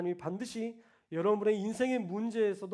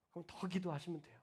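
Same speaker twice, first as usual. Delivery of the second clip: whisper; 2 semitones lower, 9 dB quieter than usual. Real, not acted.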